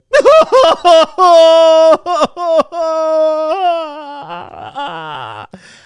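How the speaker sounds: loud, high-pitched imitation of hysterical wailing; hammed-up, drawn-out howling sob. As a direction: break into an exaggerated crying fit. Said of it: "going hysterical".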